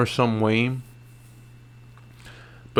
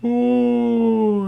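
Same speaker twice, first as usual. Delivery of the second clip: high mock groan of a falling fighter; mock pained ooh; 12 semitones higher than usual. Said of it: "He was just like".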